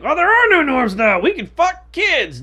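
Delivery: high, loud sarcastic celebration; mock gleeful proclamation. Imagined sixties free-lovers announcing no norms, mock glee. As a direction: cheer it out as an absurd strawman.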